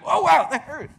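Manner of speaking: very loud, high yelp of pain; mock pained yelp. Pantheist who sat on a pin, mock pained yelp. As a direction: cry out like you've just been jabbed, hammed up.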